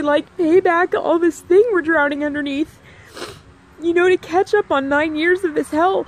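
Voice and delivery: high, loud voice close to tears, wanting out; tearful pleading. Retold or imitated. Retelling to her physical therapist, tearful pleading. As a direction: pour it out near sobbing, begging to go back to life. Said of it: "I just cried 'cause I was just like".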